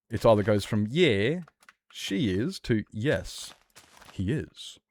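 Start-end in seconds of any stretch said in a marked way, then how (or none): 0.9-2.6 relaxed casual. Shown as a demonstration. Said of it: "One's very relaxed". 2.9-4.8 professional. Shown as a demonstration. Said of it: "more professional".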